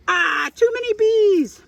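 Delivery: high, loud, slow panicked wail; overwhelmed by bees, voice shooting up; panicked puppet cry. Acted. Puppet Edgar wailing at the swarming bees, high and loud.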